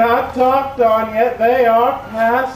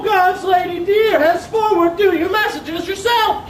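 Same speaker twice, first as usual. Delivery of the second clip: exasperated outburst; 7 semitones higher than usual. Acted.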